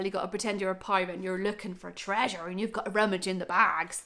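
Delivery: put-on broad Norfolk accent, playful pirate fun. Vlogger urging viewers to rummage like pirates, in a Norfolk accent. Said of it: "That was my Norfolk accent".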